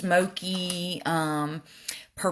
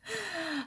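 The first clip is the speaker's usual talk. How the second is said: a breathy little laugh, much softer; 12 semitones higher, 11 dB quieter than usual. Real, not acted. Vlogger laughing off comparison to pro artists, soft laugh. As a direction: laugh quietly at yourself.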